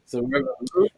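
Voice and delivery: mock-tearful little squeak; mocking echo. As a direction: echo a whiny protester in falsetto. Echoes the mocked crying woman.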